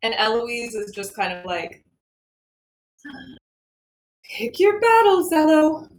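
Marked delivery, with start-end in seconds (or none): 4.2-5.9 resigned. Acted. Aunt muttering resigned.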